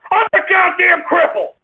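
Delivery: high-pitched indignant shouting; angry yell. Real, not acted. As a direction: yell the order, pitch climbing. Host yells amid prank sounds.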